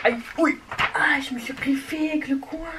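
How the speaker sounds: real pained yelp, pitched high; cri de douleur. Real, not acted. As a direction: yelp from a sudden scratch, voice jumping up. Se griffe avec le coin. (cri de douleur aigu, sur le vif)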